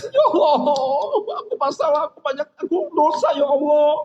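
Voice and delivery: high, wailing acted sob; demonstrated crying prayer; tearful confession. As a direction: plead to God as if weeping over sins. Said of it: "tahajud kita nangis".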